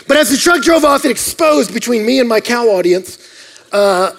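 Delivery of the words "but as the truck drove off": usual speaking voice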